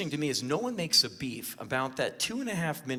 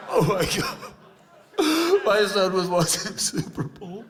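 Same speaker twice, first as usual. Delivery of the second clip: mock tearful mother; 4 semitones higher, 3 dB louder than usual. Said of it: "a woman in tears testifying".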